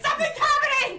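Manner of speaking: high, loud excited squeal; really excited. Acted. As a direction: play an over-excited dice god. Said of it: "dice gods got really excited".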